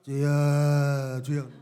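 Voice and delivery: mock sluggish, drawn-out call; mocking lazy believers' slow prayer; lazy drawl. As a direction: imitate a half-hearted prayer. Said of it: "어떤 새끼들은 기도하라고 하면".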